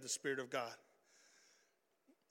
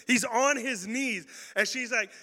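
usual speaking voice

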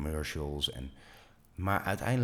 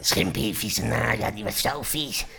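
louder, higher, slow put-on puppet voice; Schimpie voice; 5 semitones higher, 8 dB louder, slower than usual. Shown as a demonstration. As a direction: do the Schimpie character voice. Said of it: "een stem als Schimpie bedenkt". (luide, hoge Schimpie-stem)

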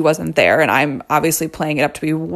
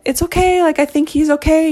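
much higher, sing-song nurse; soothing nurse; 11 semitones higher than usual. Retold or imitated. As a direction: coo like a patient nurse. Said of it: "And she's like".

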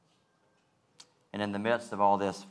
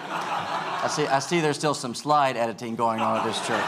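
laughing; 6 semitones higher, 7 dB louder than usual. Real, not acted. Laughs at the slide editing.

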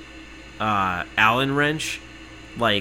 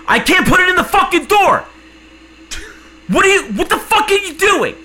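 much higher and louder furious yelling rant; furious shout; 12 semitones higher, 9 dB louder than usual. Retold or imitated. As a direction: scream it at the useless support agent. Ranting at support rep.

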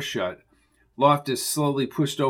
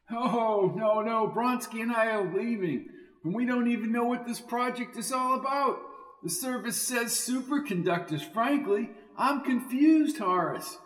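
higher, friendly calling back; higher character voice; 10 semitones higher, 6 dB quieter than usual. A narrator's reading. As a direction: call back to the trunk. Vernon playing dumb.

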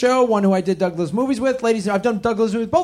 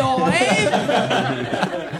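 mock New Jersey accent; 10 semitones higher, slower than usual.

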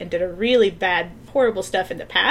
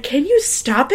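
laughing exasperation; 4 semitones higher, 4 dB louder than usual. Retold or imitated. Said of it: "she's like".

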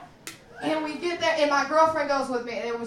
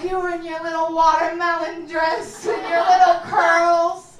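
louder, slow, cooing mom; doting mom; 4 semitones higher, 7 dB louder than usual. Retold or imitated. Mom recalling her toddler, cooing slow and loud. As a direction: gush like a proud mother. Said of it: "She was like".

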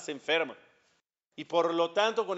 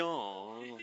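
low, soft, nasal sad sigh of a word; nasal mopey oh; 7 semitones lower, 10 dB quieter than usual. Retold or imitated. Rabbi imitating the sad boy, a low soft nasal oh. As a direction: mimic a heartbroken teen's nasal groan. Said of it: "Así hasta gangosito".

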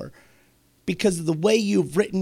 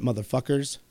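usual speaking voice

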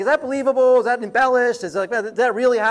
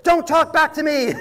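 mock scold, higher and louder; scolding a dog; 9 semitones higher, 5 dB louder than usual. Woman scolding her dog while driving away, mock loud scold. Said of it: "you can hear this woman say".

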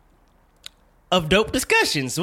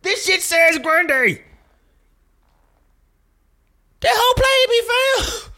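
much higher and louder, slow, disbelieving outrage; outraged shout; 13 semitones higher, 6 dB louder, slower than usual. Real, not acted. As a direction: yell it, stretched out, fully scandalised. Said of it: "Now I'm mad".

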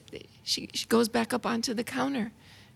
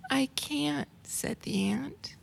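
whispered, slower little ant voice; storybook whisper; 3 semitones higher, 4 dB quieter, slower than usual. Acted. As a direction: breathe it out like a tiny timid ant. Ant's small voice in story.

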